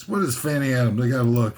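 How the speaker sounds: ordinary talk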